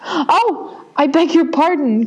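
high, quick apology; apologetic cry. A narrator's reading. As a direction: blurt out the apology. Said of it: "cried Alice again".

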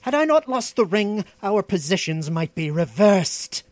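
high, loud start, indignant threat; resentful sorcerer flaring up; menacing. A narrator's reading. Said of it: "growled the Stygian, his dark eyes flickering".

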